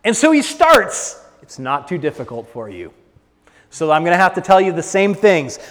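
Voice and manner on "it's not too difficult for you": low, soft and soothing; gentle reassurance. Acted. Preacher answering the people's doubts, quietly reassuring. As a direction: drop right down to calm the doubt.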